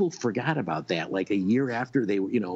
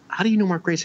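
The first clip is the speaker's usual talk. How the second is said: lightly voiced Artie, pitched higher in disbelief; imitating Artie; 9 semitones higher, faster than usual. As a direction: mimic Artie's surprised question. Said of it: "So he turns to me and goes".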